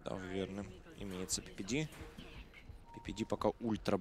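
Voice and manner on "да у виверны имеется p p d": ordinary talk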